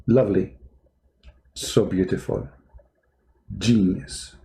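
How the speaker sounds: dry sarcastic praise; deadpan mock admiration. Real, not acted. Sarcastic praise after laughing.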